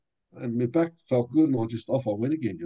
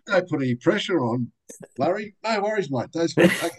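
much higher, breezy "No worries, mate"; eager reassurance; 9 semitones higher than usual. Retold or imitated. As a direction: brush off the pressure cheerfully.

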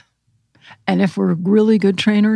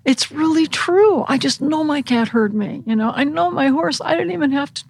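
amazed student; 7 semitones higher than usual. Retold or imitated. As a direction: gush like a student who finally believes.

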